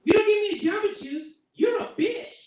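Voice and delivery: put-on high, loud bratty child voice; bratty kid. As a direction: snap at mom like a spoiled kid. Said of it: "she was like".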